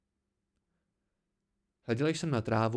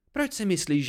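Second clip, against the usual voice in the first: high, louder indignant question; furious challenge; 7 semitones higher, 5 dB louder than usual. A narrator's reading. Dena challenges what he knows of her, furious. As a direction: challenge them hotly. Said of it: "v očích zuřivost".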